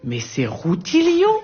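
plus haut et lent, stupéfait; découvrir Rutilio avec stupeur. Pretend. Reconnaît Rutilio sous l'eau. (le narrateur reconnaît Rutilio, stupéfaction haute et lente)

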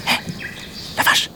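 tiny hushed mantis call. Acted. Mantis calls the cow from a bush in a tiny voice. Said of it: "une toute petite voix".

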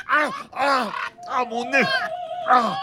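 high cries of pain; pained yelling. Acted. Captain bitten on the nose by the parrot, yelping. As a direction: yelp as your nose gets nipped.

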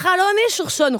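put-on Lola's thick Spanish accent, stumbling on French names. (accent espagnol appuyé, bute sur les noms français)

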